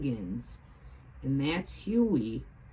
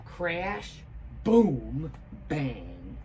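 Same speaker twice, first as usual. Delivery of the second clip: dramatic crash; 5 dB louder, much slower than usual. A narrator's reading. Sound effects read.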